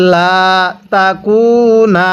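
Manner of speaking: লা তাকুনা with the লা stretched one alif long; slow recitation of লা তাকুনা; long vowel drawn out. Shown as a demonstration. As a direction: draw out the লা, then তাকুনা slowly. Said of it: "কিভাবে পড়ব?".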